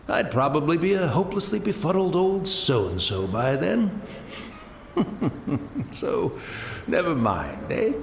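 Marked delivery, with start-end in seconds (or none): 4.9-5.9 a rising self-mocking chuckle; light chuckle. Old man laughing at his future befuddlement.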